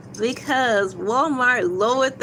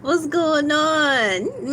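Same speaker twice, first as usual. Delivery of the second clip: ordinary talk, nothing marked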